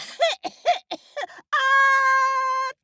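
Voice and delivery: loud, high hacking cough; giant cough. Acted. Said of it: "sneezed and coughed".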